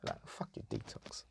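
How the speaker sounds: a whispered jab. Real, not acted. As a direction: hiss it under your breath, mocking. Mocking the album in aside.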